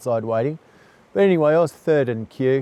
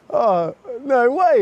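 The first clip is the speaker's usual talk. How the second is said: higher, slow amazed laugh; laughing disbelief; 11 semitones higher, slower than usual. Retold or imitated. To the stranger with the same accident, laughing disbelief. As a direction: stretch out the amazement.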